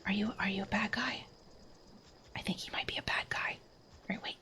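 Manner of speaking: hushed, breath-only whisper; very soft stealthy muttering under the breath. Real, not acted. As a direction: whisper as if sneaking up on someone. Sneaking up on enemies in game.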